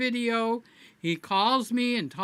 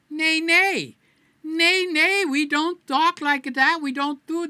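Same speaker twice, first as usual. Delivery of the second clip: higher, louder mock-stern nay nay; mock scolding; 9 semitones higher, 7 dB louder than usual. Scolding viewers playfully for his violent joke, mock stern. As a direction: wag a finger at the chat.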